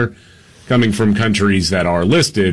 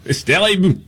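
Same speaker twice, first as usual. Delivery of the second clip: high, hammed-up French accent; mock French accent; 5 semitones higher than usual. Host mocking Macron in a news bit, hammed-up French accent. Mocking France's president in banter.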